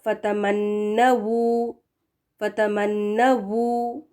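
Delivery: "फतमन्नवू" slow, lips rounded on the final W. Shown as a demonstration. Teacher modelling final waw, very slowly. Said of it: "होंठों को गोल रखें".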